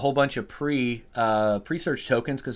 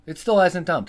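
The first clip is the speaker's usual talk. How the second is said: exasperated louder repeat; 7 semitones higher, 6 dB louder than usual. Real, not acted.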